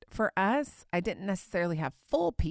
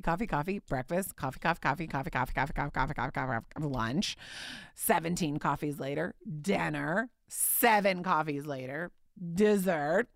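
ordinary talk, nothing marked

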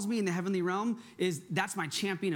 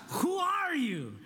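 slow, high-pitched Princess Bride accent; 9 semitones higher, much slower than usual. Shown as a demonstration. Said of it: "say it with that accent".

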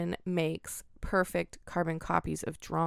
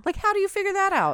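amazed; 14 semitones higher, 6 dB louder, faster than usual. Real, not acted. Amazed at the discovery.

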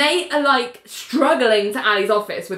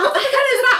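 shocked Ali; faster than usual. Retold or imitated. Ali hearing singing through the door, high startled squeal. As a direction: squeal the question in alarm. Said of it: "And Ali's like".

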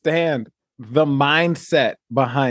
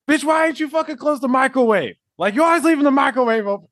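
higher, faster nagging partner voice; hammed-up scolding about the microwave; bickering like an old married couple; mock domestic quarrel; 12 semitones higher, faster than usual. Mock bickering couple over chess.

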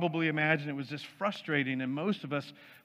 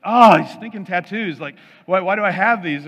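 much louder, higher, hammed-up frustration; mock exasperation; 4 semitones higher, 12 dB louder than usual. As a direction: groan at yourself in mock annoyance. Exasperated self-quote about tattoos.

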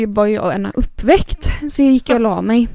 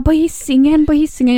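higher, repeated insistent answer; insistent mother; 6 semitones higher than usual. Retold or imitated. Mother telling daughter where the fire is, insistent. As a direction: imitate a mother hurriedly naming the place.

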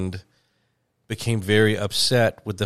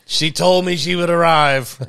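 mock hopeful Welshman; 7 semitones higher, 6 dB louder than usual. Playing waiting Welshman.

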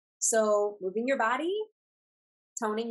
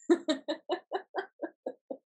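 fake laugh; 8 semitones higher than usual. Shown as a demonstration. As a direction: start a giggle on purpose. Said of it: "if you start to fake laugh".

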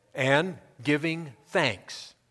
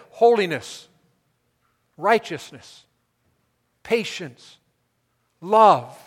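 loud, raised preaching with weighty pauses; emphatic preaching; 6 semitones higher, 10 dB louder than usual. Real, not acted. Preacher listing what Christ's name stands for, emphatic preaching. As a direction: drive each word home to the congregation.

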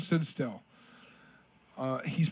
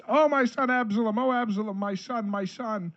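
higher, louder grieving cry; loud lament; 5 semitones higher than usual. Acted. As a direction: wail it like a grieving father. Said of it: "cried with a loud voice".

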